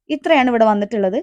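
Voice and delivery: usual speaking voice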